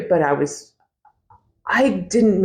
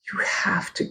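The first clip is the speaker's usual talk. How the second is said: softer, breathy whisper of a nudge; whispered urging; 3 semitones higher, 5 dB quieter than usual. Retold or imitated. Husband nudging his wife during the service: urgent whisper. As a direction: hiss it quietly at your wife.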